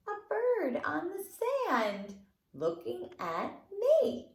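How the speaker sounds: sing-song rhyme voice; children's fingerplay rhyme. Acted. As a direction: lilt it for toddlers. Recites beach fingerplay.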